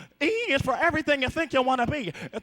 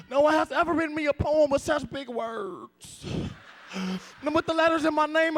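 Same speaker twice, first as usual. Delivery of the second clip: usual speaking voice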